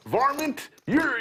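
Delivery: high, loud imitation of an old cowboy; mock an old prospector yelling varmint. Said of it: "pretending he was a s-, uh, old, a cowboy".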